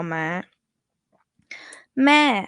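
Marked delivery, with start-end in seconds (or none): 2.0-2.4 "Ma" high and loud, then falling. Shown as a demonstration. Teacher demonstrates the falling tone of Ma. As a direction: let "Ma" drop from a high start. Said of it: "falling tone".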